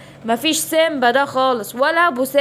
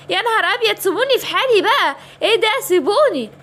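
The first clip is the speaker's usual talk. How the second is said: frightened princess; 7 semitones higher than usual. Acted. Princess kidnapped by hyenas.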